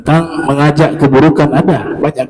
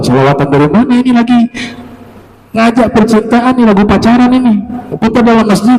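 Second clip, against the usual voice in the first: mock scolding; 6 semitones higher than usual. Teacher scolds the song player.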